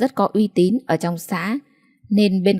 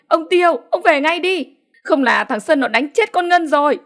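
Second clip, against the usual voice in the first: frantic shout; 9 semitones higher than usual. A narrator's reading. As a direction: scream for help, pitch flying up. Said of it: "Bà gào lên để gọi". (gào hoảng hốt, giọng cao vút)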